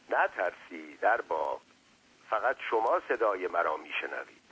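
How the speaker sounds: plain reading voice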